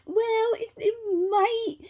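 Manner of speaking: high-pitched evasive doctor imitation; mock sugarcoating. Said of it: "tried to sugarcoat it and be like".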